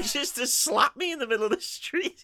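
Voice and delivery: high, incredulous comic rant; mock indignation. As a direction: rant as the wronged victim, for laughs. Acting out slapped April's indignation.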